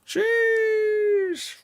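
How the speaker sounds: very high sustained falsetto; high-pitched squeal. Real, not acted. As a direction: squeal it out in a long, thin falsetto. Squealed exclamation praising the viewer's plan.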